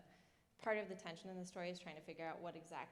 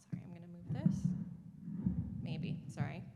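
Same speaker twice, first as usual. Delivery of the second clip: whispered aside; 8 dB louder than usual. Real, not acted. Aside while adjusting mic.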